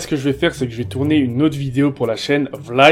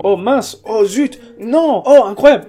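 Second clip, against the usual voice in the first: higher-pitched sample cries of surprise; acted astonishment; 6 semitones higher, 4 dB louder, slower than usual. Shown as a demonstration. Vlogger showing how he will react in videos, acting astonished. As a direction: exclaim as if amazed, pitch jumping up. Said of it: "à chaque fois que je vais être étonné".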